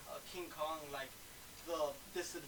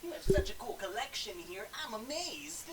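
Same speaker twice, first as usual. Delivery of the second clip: put-on gee-whiz kid; 5 semitones higher than usual. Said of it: "Golly gee willikers mister".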